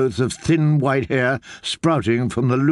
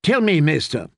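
ordinary delivery, nothing marked

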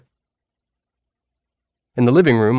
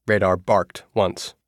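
ordinary delivery

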